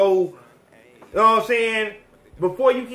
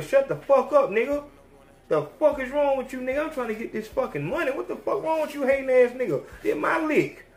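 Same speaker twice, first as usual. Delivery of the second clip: higher, heated telling-off; angry Shotty; 7 semitones higher than usual. Retold or imitated. Shotty angrily telling the hating rat to shut up, higher. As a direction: snap at the hater, pitched up. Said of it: "If I'm Shotty, I'm telling".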